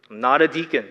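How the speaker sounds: ordinary talk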